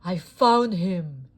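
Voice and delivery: slow, loud villain voice; gruff stranger. A narrator's reading. Thief gloating over finding Woody, slow and loud. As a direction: growl it slowly like a greedy thief. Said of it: "he said".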